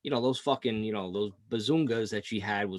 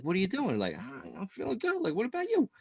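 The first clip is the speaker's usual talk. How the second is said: high, soft, coy imitation; playfully teasing, rising high and light; mock flirting; 9 semitones higher, 4 dB quieter than usual. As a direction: act out a flirty back-and-forth, pitched way up. Acting out flirty texting exchange.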